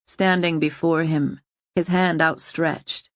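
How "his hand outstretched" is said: plain reading voice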